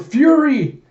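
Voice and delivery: high, loud cry of alarm; acted desperate shout; calling out. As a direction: scream the name across a battlefield. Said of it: "Koyomi calls out".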